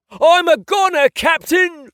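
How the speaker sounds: loud, hammed-up English accent cry; mock dying pirate. Pretending to die in pirate play, hammed-up. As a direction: overplay a dying sailor's cry.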